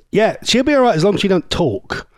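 normal talking voice

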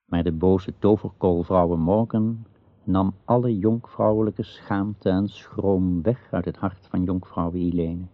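usual speaking voice

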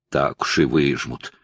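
low, slow sceptical retort; snapping back. A narrator's reading. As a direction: snap back under your breath, drawn out. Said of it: "огрызнулся Глеб на Алексея". (огрызаясь, недоверчиво)

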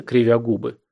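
usual speaking voice

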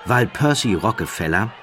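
plain reading voice

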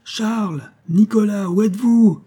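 calling out. A narrator's reading. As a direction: hail the missing from afar. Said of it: "crient les voix en s'approchant".

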